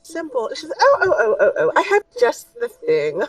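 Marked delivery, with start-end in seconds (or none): 0.0-0.5 high, soft shopkeeper reply; a prim merchant's one-word answer. Acted. GM voicing the clothier to the party, high and prim. 0.8-3.2 high giggling oh oh oh; delighted laughing shopkeeper. Acted. Shopkeeper to the customer, giggling with delight.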